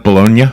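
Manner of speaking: Bologna said "buh-LOHN-yuh", questioning; Italian-style pronunciation. Shown as a demonstration. Host checks the town's real name, Bologna as "buh-LOHN-yuh". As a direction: end Bologna on a rising "-nya". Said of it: "it's pronounced Bologna?".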